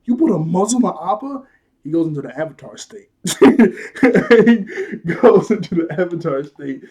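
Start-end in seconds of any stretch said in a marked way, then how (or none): 0.0-1.4 high, loud, disbelieving question; hyped-up outrage. Retold or imitated. Aang learning his bison was muzzled, loud mock outrage. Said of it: "Aang is getting hyped up". 3.2-6.8 slow, loud, higher, through laughter; laughing. Real, not acted. Reviewer tickled by Aang's transformation, real laughing.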